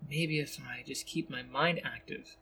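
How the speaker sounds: inner thought. A narrator's reading. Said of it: "he thinks".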